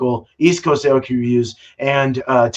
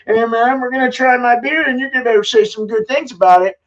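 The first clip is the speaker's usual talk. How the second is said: higher, hammed-up salesman voice pushing his beer; mock pushy brewer; 10 semitones higher than usual. Brewer mocking himself to friends, hammed-up boastful voice. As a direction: play a show-off pushing his own beer. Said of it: "You know, I'm not like".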